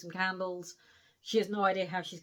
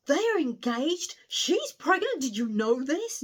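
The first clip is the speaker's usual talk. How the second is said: high, loud, breathless news blast; shocked gossip; 6 semitones higher, 7 dB louder than usual. Acted. Frankie blurting the engagement news to Matty, shocked and loud. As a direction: blurt the scandal out in alarm.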